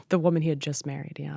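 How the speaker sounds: soft, low, sinking into a whisper; real quiet hurt; hushed confession. Real, not acted. Confiding the painful betrayal.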